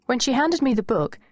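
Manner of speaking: ordinary delivery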